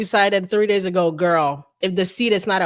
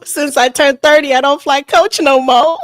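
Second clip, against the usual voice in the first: mock diva; 6 semitones higher, 5 dB louder than usual. Guest bragging to host about first class, hammed-up diva voice. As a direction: play the spoiled star who won't fly coach. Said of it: "You're too diva-like for me".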